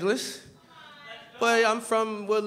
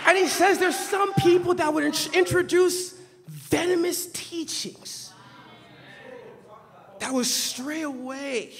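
fiery preaching; 7 semitones higher, 3 dB louder than usual. Acted. Preacher thunders at his congregation, then drops to a hush. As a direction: preach it hard from the pulpit, then let it drop.

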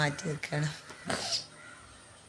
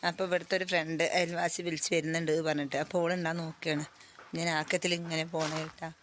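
ordinary talk, nothing marked

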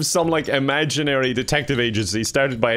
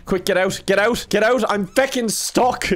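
urgent frustration; 6 semitones higher than usual. Real, not acted. Stuck robot in game.

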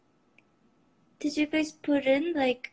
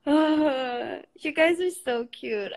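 ordinary talk

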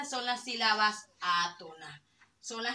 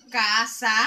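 casa stretched loud, CAA-sa; casa in two forceful beats; exaggerated stress; 8 dB louder than usual. Shown as a demonstration. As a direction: belt out the ca of casa. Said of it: "Yo puedo realizar".

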